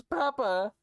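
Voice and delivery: put-on high, pitiful little-kid voice; imitated sad child. Dad imitates his son pleading after a scolding, sad voice. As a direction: mimic a child's sad plea. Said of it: "he said it in, like, this really sad voice".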